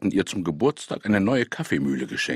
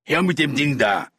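harsh, louder commanding call; gruff robber's demand; 4 semitones higher, 4 dB louder than usual. A narrator's reading. Robber demanding grandmother's coffee mill, gruff. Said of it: "eine barsche Stimme rief".

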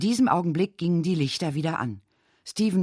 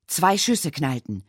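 plain reading voice

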